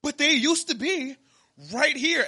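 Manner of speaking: mock the customer digging in her heels; imitating an insistent shopper.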